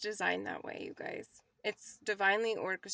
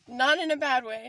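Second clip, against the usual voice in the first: louder, higher "not in a bad way"; emphatic; 4 semitones higher, 10 dB louder than usual. Real, not acted. Reassuring viewers about the money bag, loud and emphatic.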